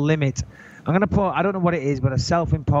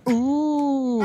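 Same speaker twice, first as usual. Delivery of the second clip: high, teasing mock ooh; a high mock gasp of awe at his own threat; hammed-up high ooh; 10 semitones higher than usual.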